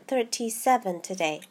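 plain reading voice

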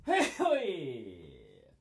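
loud real sneeze; big sudden sneeze out loud; sneezing. Real, not acted. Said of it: "綺麗なくしゃみ出たね".

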